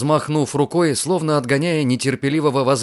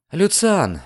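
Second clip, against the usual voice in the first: usual speaking voice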